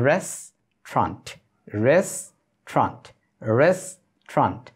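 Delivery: "রেস্টুরেন্ট" slow and clipped to restrant, repeated; restaurant drill. Shown as a demonstration. Teacher drilling learners on saying restaurant. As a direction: say "রেস্টুরেন্ট" slowly as two beats, restrant. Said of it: "উচ্চারণগুলো খেয়াল করবেন".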